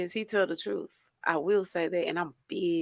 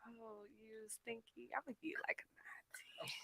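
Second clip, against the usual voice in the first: playful whisper; 4 semitones higher, 22 dB quieter than usual. Real, not acted. Playful rating of a friend.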